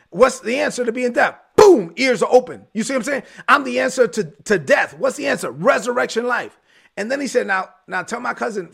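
emphatic preaching with punched key words; voicing Jesus's message with pulpit force; fervent preaching. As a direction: preach it in driving, rhythmic call-and-answer lines.

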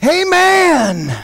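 high, loud revival preaching; full-force preaching. Acted. Preacher urging the church to honor a mother's confidence, full-force preaching. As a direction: belt it out to rouse the congregation.

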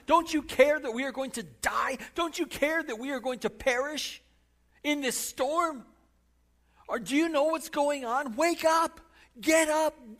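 high, loud frantic plea; panicked shouting. Acted. Terrified disciples shouting to wake Jesus in the storm. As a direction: scream for help over the storm.

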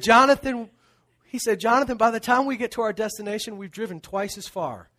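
a hint of dad ribbing his son, laughing through it; imitating dad. Dad scolding a weaving teenage driver, lightly imitated teasing.

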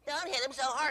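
daughter's protest. Retold or imitated. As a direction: plead for the dog. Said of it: "my dog says".

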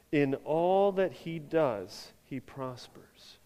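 slow, deliberate reading together; slow group reading. Shown as a demonstration. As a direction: read it slowly with the congregation. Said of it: "Let's read it on the count of three".

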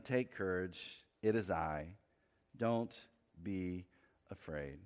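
low, slow, softening to a hush; calm reassurance. A narrator's reading. Jesus to his frightened disciples, calm slow reassurance. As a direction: read it gently, with long pauses between words.

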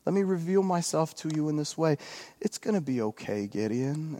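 soft, sugary, soothing Jesus voice; mock gentle Jesus. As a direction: coo it like a cozy picture-book Jesus. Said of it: "does not say something".

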